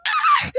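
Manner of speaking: high shriek of joy; excited scream. Real, not acted. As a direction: shriek with delight. Reveals lucky blind box.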